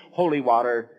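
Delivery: usual speaking voice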